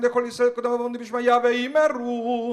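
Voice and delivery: fast, high chanted Kaddish; rapid prayer recitation. Acted. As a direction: rattle the Kaddish off quickly.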